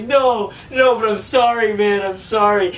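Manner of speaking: high pleading sorry; apologetic drunk. Retold or imitated. Drunk friend apologizing to stranger, high and pleading. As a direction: beg forgiveness in a high whine.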